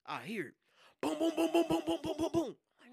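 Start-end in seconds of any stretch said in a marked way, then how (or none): 1.0-2.6 loud, high booms mimicking pounding on a door; imitated door banging. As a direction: hammer out the knocks with your voice.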